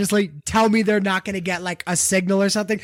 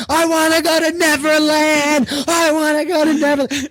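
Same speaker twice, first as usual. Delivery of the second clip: mock wailing; 7 semitones higher, 5 dB louder than usual. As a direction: cry out like a hammed-up desperate prisoner. Acted prisoner wailing for Neverland.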